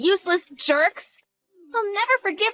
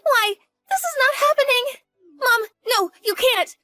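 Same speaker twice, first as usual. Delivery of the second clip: panicked; 5 semitones higher than usual. Acted. Daughter panics as father arrives.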